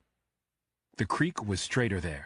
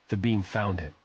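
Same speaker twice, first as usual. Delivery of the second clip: usual speaking voice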